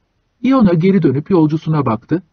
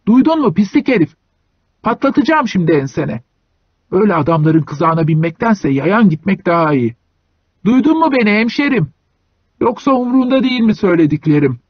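angry threat; 5 semitones higher than usual. Acted. Hunchback threatening the driver.

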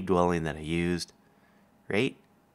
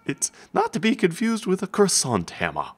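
mock grand pitchman; 10 semitones higher than usual. Mock pompous wrench line.

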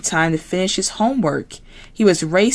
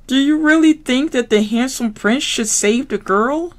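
higher frog voice; Kermit voice; 8 semitones higher than usual. Acted. Kermit answering Piggy on the phone, frog voice.

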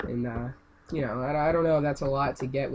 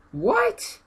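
very high squeak of disbelief; baffled what; 4 dB louder than usual. Real, not acted. Gamer baffled by his death, high squeak. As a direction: yelp in confusion.

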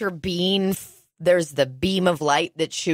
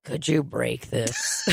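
imitating Donald; 6 semitones lower, 4 dB quieter, slower than usual. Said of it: "And then he goes".

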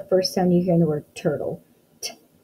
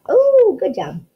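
excited praise; 13 semitones higher, 6 dB louder, slower than usual. Acted.

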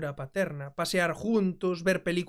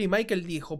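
usual speaking voice

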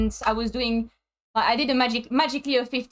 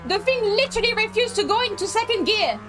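exasperated; 9 semitones higher than usual. Real, not acted. Complains about the gearbox.

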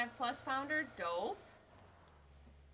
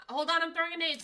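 loud, higher warning shout; grenade warning yell; 7 semitones higher, 10 dB louder, faster than usual. Real, not acted.

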